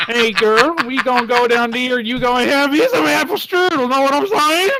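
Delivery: high, loud Southern twang; hammed-up Alabama chat-up with a twang. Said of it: "you have the twang in there".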